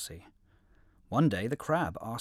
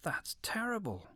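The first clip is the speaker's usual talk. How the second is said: shocked crab; 9 semitones higher, 5 dB quieter than usual. Acted. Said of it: "cried the crab".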